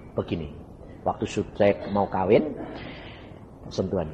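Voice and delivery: usual speaking voice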